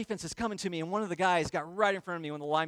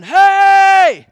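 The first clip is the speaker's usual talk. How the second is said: very loud, high drawn-out shout; reenacted yell; 14 semitones higher, 22 dB louder than usual. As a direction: yell out for attention at full volume. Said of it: "I'm yelling".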